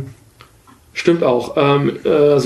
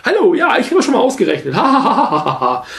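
high, grating robot voice with a hammed-up laugh; nerviger Roboter; 7 semitones higher than usual. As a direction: play the annoying robot helper, cackling. Herbie nervig imitiert, lacht. (nervige hohe Roboterstimme mit Lachen)

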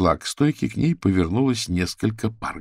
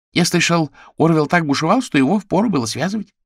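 higher animal voice; 9 semitones higher, 5 dB louder than usual. Acted.

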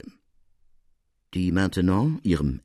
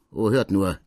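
bitter smile. Acted. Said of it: "mit bitterm Lächeln".

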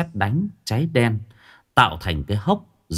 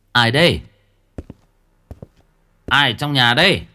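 raised-voice challenge, pausing between calls; calling out warily; 3 semitones higher, 4 dB louder than usual. Acted. Uncle Hà calling to whoever is inside, raised voice. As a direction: call into the house, louder.

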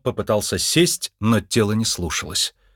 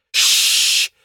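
sharp, voiceless cat hiss; 4 dB louder than usual. Acted. Cat hisses at doctor. (кошачье шипение)

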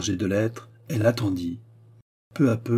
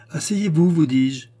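much higher and louder, insistent order; insistent command; 7 semitones higher, 5 dB louder than usual. A narrator's reading. The queen orders the faltering Charny to sit, insistently. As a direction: repeat it forcefully so he obeys.

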